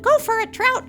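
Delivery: very high, loud squeaky cheer; an ant cheering the fish on at his work; squeaky ant shout. Acted. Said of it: "in his squeaky ant voice".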